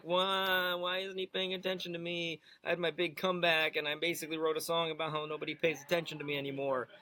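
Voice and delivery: mock crybaby wail, long level notes. Co-host mocking the ignored rapper, whiny wail. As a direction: wail it like a sulky child. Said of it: "[name] is now like".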